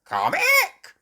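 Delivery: very high, loud squawking parrot. A narrator's reading. Polly copying Granny, high squawking parrot. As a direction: screech it like a parrot mimicking. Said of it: "Polly Parrot copied".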